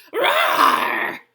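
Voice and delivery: huge, high bear roar; furious bear growl. A narrator's reading. Old Bear is mad about losing at dreidel, roaring. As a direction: roar at full force like an outraged bear. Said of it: "growled Old Bear".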